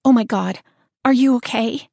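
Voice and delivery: panicked check on a sister; worried. A narrator's reading.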